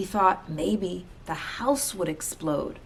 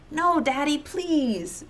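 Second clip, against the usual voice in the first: put-on high, begging child's plea; high pleading child; 10 semitones higher, slower than usual. A narrator's reading. Children begging their father to keep the dog, high and pleading. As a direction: beg Father like a small child. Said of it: "begged Betty and Billy".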